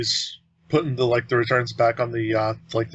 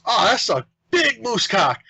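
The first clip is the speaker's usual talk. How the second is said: loud blurt; 9 semitones higher than usual. Retold or imitated. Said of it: "as loud as she possibly could".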